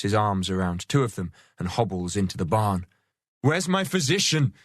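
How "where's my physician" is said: high shout rising into a scream at the end. Acted. Wounded earl shouting for his physician, high shout. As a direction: yell for the physician, scream the last syllable. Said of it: "he shouts".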